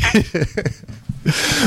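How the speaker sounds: a short real laugh, high up; high breathy laugh. Real, not acted. Host laughs after guest reply.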